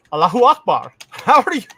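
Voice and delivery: hammed-up Arabic greeting meant to alarm the caller; mock Arabic accent. Trolling the FBI caller with a loud mock Arabic accent. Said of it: "And I just go".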